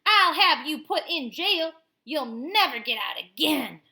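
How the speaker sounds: loud, furious threat; angry yelling. Acted. Mother yells a threat at the girl, furious and loud. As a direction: yell it at the girl. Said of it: "She immediately started yelling".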